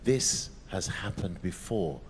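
hushed, low murmur; whisper. Acted. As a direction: say it barely above a whisper. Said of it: "he whispers".

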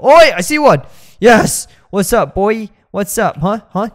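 loud high shout of glee; real thrilled shouting; excited yell. Real, not acted. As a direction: yell out the find. Spots ghost, yells excitedly.

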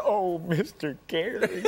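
high, drawn-out mock gushing; imitated fawning fan. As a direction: stretch it out like a starstruck admirer. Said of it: "something like".

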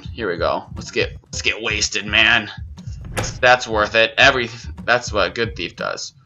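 usual speaking voice

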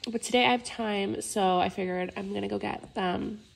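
ordinary talk

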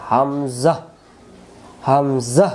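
slow হামজা with a firm throat catch at the start; hard hamza. Shown as a demonstration. Teacher demonstrating the hard hamza for students. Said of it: "শক্ত করে আওয়াজটা দিবেন".

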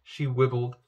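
plain reading voice

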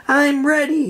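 high eager cry; mild shout. A narrator's reading. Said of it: "he shouted".

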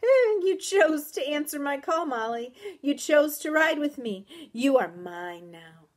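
triumphant, menacing creature voice; gloating horse. A narrator's reading. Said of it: "His whinny sounded like a laugh".

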